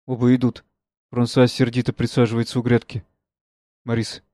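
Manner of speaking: ordinary talk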